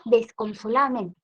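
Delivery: normal talking voice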